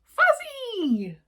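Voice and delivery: put-on little girl delighted by the monster. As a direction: squeal it like a happy toddler.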